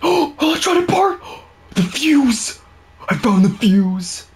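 high thrilled exclamation; excited. Real, not acted. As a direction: shout out the discovery. Finding the sought item.

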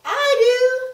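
high, loud bold cry; knight's cry. Acted. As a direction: shout it like a brave knight. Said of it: "cried the knight".

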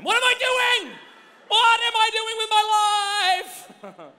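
high, loud, drawn-out cry of mock despair; mock despairing shout. Speaker mocking his own endless app-hopping, hammed-up despairing shout. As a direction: wail it at your own scrolling habit, hammed up.

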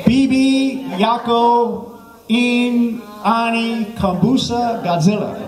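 high, drawn-out foreign phrase; mock language-lesson recitation. Shown as a demonstration. Comic teaching the crowd Swahili, slow high recitation. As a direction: intone each word slowly and high for repeating.

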